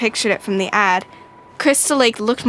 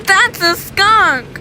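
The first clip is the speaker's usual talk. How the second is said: slow, high, drawn-out complaint; disgusted Brother; 9 semitones higher, 4 dB louder, much slower than usual. Acted. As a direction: announce the stink with disgust.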